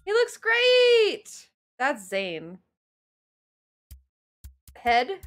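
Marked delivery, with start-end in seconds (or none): 0.0-1.5 drawn-out high exclaim. Real, not acted. Exclaiming over the character.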